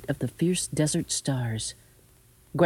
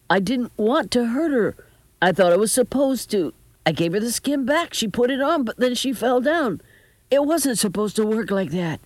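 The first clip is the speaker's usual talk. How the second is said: high, upset, protesting voice; distraught grandson, voice pitched up; anguished pleading; 7 semitones higher, 3 dB louder than usual. A narrator's reading. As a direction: plead that you meant no harm.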